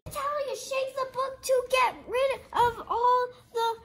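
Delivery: excited loud instruction; urgent call. Acted. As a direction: urge her to shake it. Urgent command to reader.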